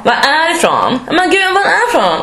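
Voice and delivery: insistent, high, badly faked Stockholm accent; attempted Stockholm accent. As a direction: press for an answer in mock Stockholm speech. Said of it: "Jag kan inte ens härma stockholmska".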